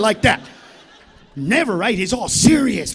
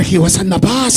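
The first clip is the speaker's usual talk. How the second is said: put-on low, loud Puerto Rican accent on bus; lowered Puerto Rican voice; a Puerto Rican guy telling where he saw it; 4 semitones lower, 8 dB louder than usual. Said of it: "Puerto Ricans are gonna be like".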